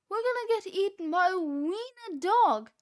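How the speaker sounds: high, loud dread; panicked cry. A narrator's reading. Cheeseball chased by a wiener dog, panicked. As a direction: wail it. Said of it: "cried Cheeseball".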